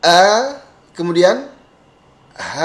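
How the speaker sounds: A then H, lips slightly smiling. Shown as a demonstration. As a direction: spread lips a little for A and H. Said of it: "Ini agak senyum". (A dan H agak tersenyum)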